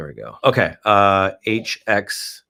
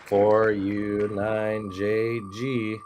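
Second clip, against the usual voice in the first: spelled out slowly; much slower than usual. Shown as a demonstration. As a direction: read each letter slowly. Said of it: "reads code back slowly".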